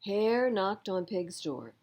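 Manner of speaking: plain reading voice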